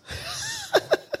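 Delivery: laughing. Real, not acted. Laughs after joke.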